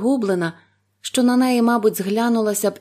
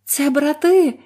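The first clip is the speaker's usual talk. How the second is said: high, loud eager question; hopeful; 8 semitones higher, 5 dB louder, slower than usual. A narrator's reading. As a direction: ask with sudden hope.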